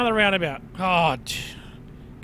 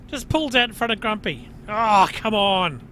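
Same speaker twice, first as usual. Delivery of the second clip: normal talking voice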